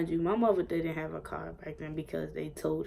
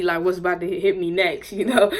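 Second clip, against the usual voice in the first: laughing; 12 dB louder than usual. Real, not acted. Laughing while retelling the beating.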